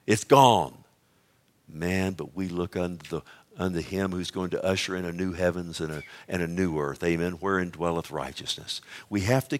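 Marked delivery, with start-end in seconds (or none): 1.7-8.8 much softer and lower; quiet awed sermon tone; hushed reverence. Real, not acted. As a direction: drop to a reverent hush.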